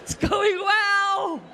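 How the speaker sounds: high, slow stretched crow; drawn-out boasting. Acted. Comic bragging that it is going well, high drawn-out crow. Repeats the gloating line.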